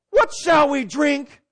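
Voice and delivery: loud, high, slow mock whine; whiny complaint. Preacher mimics Israelites grumbling for water, mock whiny complaint. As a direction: wail it like a child mid-tantrum. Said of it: "there's two ways of saying".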